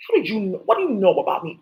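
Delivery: usual speaking voice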